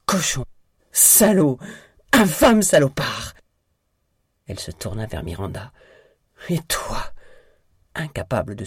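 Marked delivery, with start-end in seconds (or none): 0.1-3.3 high, loud, building shouts; furious insults. A narrator's reading. Betrayed wife lashes out at her husband, furious shouting. 6.5-7.1 higher, strained accusation; accusing. A narrator's reading. Betrayed wife rounds on her sister, accusing.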